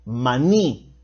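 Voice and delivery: "maní" with the weight on -ní; teacher's example, leaning on the end of "maní"; final-syllable stress. Shown as a demonstration. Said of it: "que son palabras agudas".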